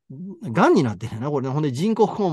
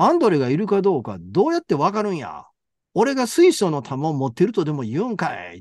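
raised, mock-exasperated father's comeback; irritated retort; 4 semitones higher than usual. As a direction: throw it back as an indignant rhetorical question. (呆れ気味に言い返す父親の芝居)

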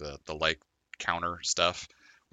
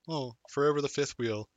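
mock lament; 8 semitones higher than usual. Mock lament of single friend.

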